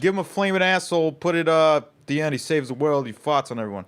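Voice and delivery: high, loud blustering studio boss; Italian Peter Griffin. Retold or imitated. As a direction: bark orders like a brash exec. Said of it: "an Italian Peter Griffin".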